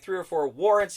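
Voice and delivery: normal talking voice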